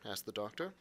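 ordinary delivery, nothing marked

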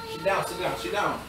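ordinary delivery

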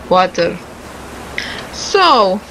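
ordinary delivery

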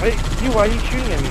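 fast, high, loud complaint; loud protest. Real, not acted. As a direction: shout a rushed protest at a teammate. Protests being shot by teammate.

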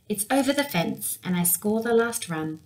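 usual speaking voice

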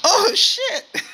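high, loud shocked cry; shocked yelp. Real, not acted. Repeated shocked exclamations.

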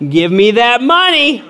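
high, drawn-out mock demand; hammed-up insistence; cocky demand. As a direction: demand it slowly with comic relish. Retold cocky demand to teller.

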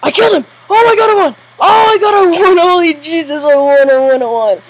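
screaming high and loud with joy; ecstatic victory shout. Real, not acted. Player just won the whole game, ecstatic shouting. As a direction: yell it out, overwhelmed by the win.

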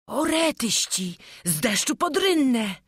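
high cry of dismay; exclaiming. A narrator's reading. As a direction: throw up your hands and cry out. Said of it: "zawołał Wojciech".

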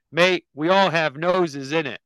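loud, slow mock British accent. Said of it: "the British dog says".

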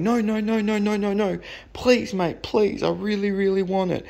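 high-pitched mock begging; imitated pleading, pitched well up. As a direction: beg frantically like a desperate buyer. Buyer begs not to cancel.

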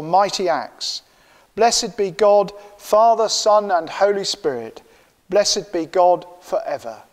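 uplifted liturgical thanksgiving; prayer. Acted.

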